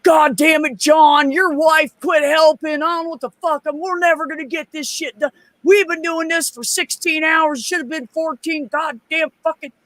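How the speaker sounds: high-pitched angry tirade, retold; mock irate hollering; imitating pissed-off ranting. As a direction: rant like a furious coworker, voice pushed way up. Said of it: "Oh, he was pissed".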